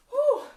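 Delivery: high, loud exhaled "whew"; winded whew. Real, not acted. Instructor recovering after the last round, high loud winded whew. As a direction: blow out a big breath of relief. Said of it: "I'm out of breath here".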